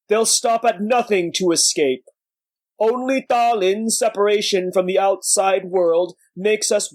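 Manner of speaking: slow, weighty elder warning; old sage voice. Acted. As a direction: warn them like a weary master. Bak Fei warns the acolytes.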